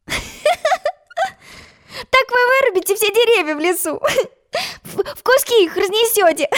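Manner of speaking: high, fast laughing through words; high giggling speech. A narrator's reading. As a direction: laugh while teasing them. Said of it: "засмеялась Алиса".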